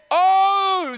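long, high, loud drawn-out oh; impassioned prayer shout; passionate cry. Acted. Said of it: "your shout's been taken out of you".

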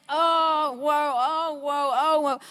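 mock flailing cries. Mimicking life beating you up.

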